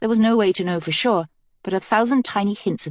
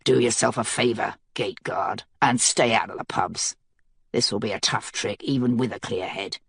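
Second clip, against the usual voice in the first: Mace's voice, low and gruff, growling a warning; 8 semitones lower, 4 dB quieter than usual. A narrator's reading. Mace warns the gate guard off the pubs, low growl. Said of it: "Mace stepped forward and growled".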